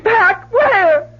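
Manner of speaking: panicked disbelief; tearful urgent questions; frantic crying question. Acted. Mother hears son returning.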